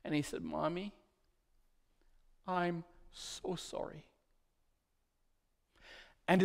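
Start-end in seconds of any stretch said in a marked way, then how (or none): 0.4-4.0 put-on tearful boy. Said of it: "tears just streaming down his face".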